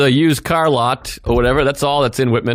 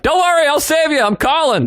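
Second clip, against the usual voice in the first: high, hammed-up heroic call; good Samaritan calling out; 11 semitones higher than usual. Good Samaritan calls to stranded man from departing truck, calling out. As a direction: call out a mock rescue promise. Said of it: "I'm like a good Samaritan".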